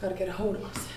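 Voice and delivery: very soft, low private murmur; steady yourself under your breath; talking to herself. Acted. Visitor alone, talking to herself.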